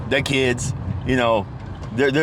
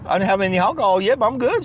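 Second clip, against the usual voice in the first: joking cheerful reply; 10 semitones higher than usual. Retold or imitated. Joking with the cashier about alcohol, cheerful higher voice. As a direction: joke with the cashier.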